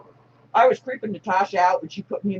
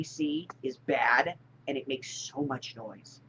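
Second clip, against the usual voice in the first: slow, hushed whisper; 5 semitones lower, 5 dB quieter, slower than usual. Real, not acted. Whispering to the viewers about the neighbor's noisy AC, hushed and slow.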